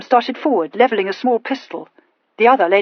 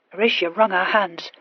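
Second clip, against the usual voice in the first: usual speaking voice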